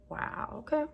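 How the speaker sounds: soft unvoiced wow, okay; hushed surprise at her own thought; whispered wow. Real, not acted. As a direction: breathe it out, a little struck. Reacting to her own words.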